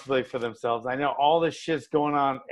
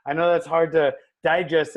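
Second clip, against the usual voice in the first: normal talking voice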